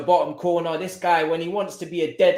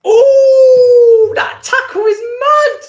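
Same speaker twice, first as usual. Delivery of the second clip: very high, very loud howl, laughing; shocked shout; 16 dB louder, much slower than usual. Real, not acted. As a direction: yell at the tackle in shock. Reaction to a wild tackle.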